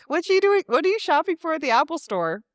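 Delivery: playful, high-pitched nosy questioning; silly teasing. Retold or imitated. Sister sillily pesters sister seen at the Apple Store. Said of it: "I just was being silly".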